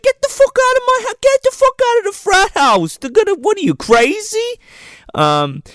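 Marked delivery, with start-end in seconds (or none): 0.0-4.6 very high, frantic loud rant; hammed-up panic, throwing someone out; mock outraged yell. Frat guy ordering the girl out of the frat house, mock outrage.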